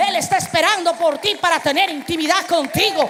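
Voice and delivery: normal talking voice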